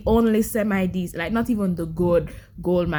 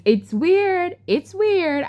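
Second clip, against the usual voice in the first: high, drawn-out exasperation; stretched, high-pitched disbelief; emphatic insistence; 9 semitones higher, 3 dB louder, much slower than usual. Real, not acted. Insisting the book is weird.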